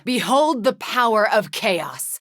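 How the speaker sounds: slow, looming sorceress proclamation; commanding witch. Acted. Witch unleashing chaos on enemies, slow commanding boom. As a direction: proclaim your dark power slowly.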